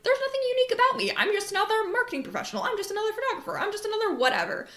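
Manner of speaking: high, fast, mock-whiny self-pity; mock insecure self-talk. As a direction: whine like someone who feels ordinary. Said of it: "it might just be like".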